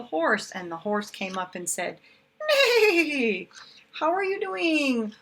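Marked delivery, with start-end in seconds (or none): 2.5-3.5 high, loud laughing neigh; whinny it out like a horse. Acted. Teacher voicing the horse for her class, a high laughing neigh. 3.9-5.1 high, bright friendly horse. Acted. The horse greeting the busy spider, high and friendly.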